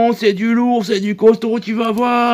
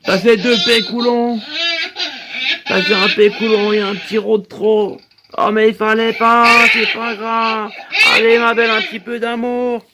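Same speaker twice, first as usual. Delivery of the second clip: put-on baby talk. Babysitter comforts crying Brenda, light baby talk. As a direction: coo at the baby to calm her.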